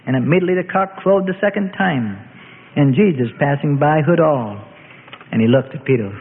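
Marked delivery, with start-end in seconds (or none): none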